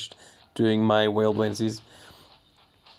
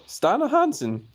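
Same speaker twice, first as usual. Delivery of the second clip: baffled; 12 semitones higher, slower than usual. Real, not acted. Baffled at chat message.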